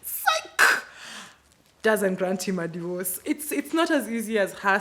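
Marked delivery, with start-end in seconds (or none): 0.0-0.8 squeaky high mocking Psych; wife mocking her husband's hopes. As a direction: taunt him in a shrill cartoon squeal. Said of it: "his wife is like".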